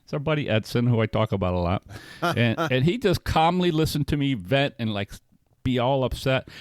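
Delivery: ordinary talk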